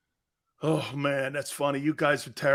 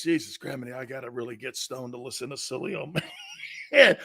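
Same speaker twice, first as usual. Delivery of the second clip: stoned Mike imitation; 5 semitones lower than usual. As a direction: play the stoned friend, drowsy then laughing. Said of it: "Mike goes like this".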